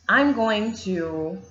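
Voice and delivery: normal talking voice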